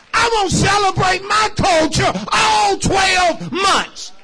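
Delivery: high, loud, rhythmic preacher's shout; rousing pulpit cry to the congregation; full-force preaching. Real, not acted. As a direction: build a chanting list and belt out the point.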